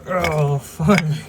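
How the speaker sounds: loud pained groan through a cramp. Real, not acted. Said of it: "Oh, I'm cramping".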